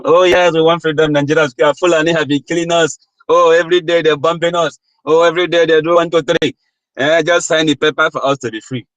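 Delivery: imitating Biafrans pleading with foreigners for freedom, hammed-up begging; mock begging Biafrans. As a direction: beg like a desperate victim to outsiders.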